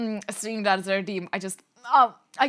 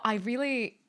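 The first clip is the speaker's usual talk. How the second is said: ordinary delivery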